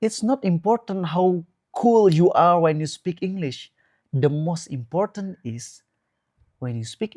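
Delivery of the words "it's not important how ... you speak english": ordinary delivery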